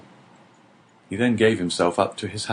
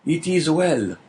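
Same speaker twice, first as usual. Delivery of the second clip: a hint of a gentle old man, pitched higher; lightly voiced old prisoner; 7 semitones higher, slower than usual. A narrator's reading. The old prisoner accepts Dante's friendship, lightly voiced. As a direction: accept it simply. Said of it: "returned the voice".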